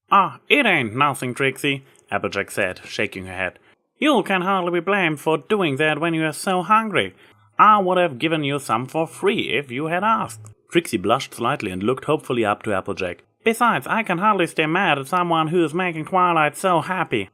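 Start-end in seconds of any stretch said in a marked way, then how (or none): 0.1-10.5 a hint of a country farm mare, kindly; easygoing farmer, a touch higher; lightly voiced Applejack. A narrator's reading. Applejack brushing off Trixie's apology, lightly voiced country mare. 13.4-17.3 a hint of a country farm mare, kindly; easygoing farmer, a touch higher; lightly voiced Applejack. A narrator's reading. Applejack brushing off Trixie's apology, lightly voiced country mare.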